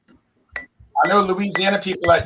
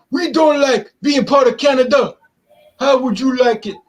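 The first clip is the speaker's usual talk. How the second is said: higher, louder whiny complaint; mock the aggrieved locals; mimicking French Canadians; 6 semitones higher, 5 dB louder than usual.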